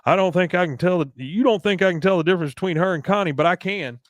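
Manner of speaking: high, loud mock Hank; imitating Hank. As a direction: warn him off, stern. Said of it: "He goes".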